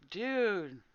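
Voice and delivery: usual speaking voice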